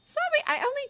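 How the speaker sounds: put-on high, loud girl's voice; Hermione voice. Hermione voice again.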